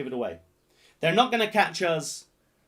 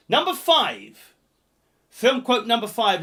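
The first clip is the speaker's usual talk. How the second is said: announcer voice; 6 semitones higher, 6 dB louder than usual. Real, not acted. Host announcing loudly.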